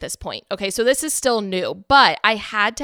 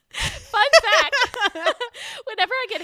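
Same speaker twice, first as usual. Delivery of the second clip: laughing. Real, not acted. Laughing after her hiccups.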